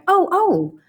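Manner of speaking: high, loud startled "Oh, oh!"; startled cry. Acted. Della leaps up like a singed cat, crying out.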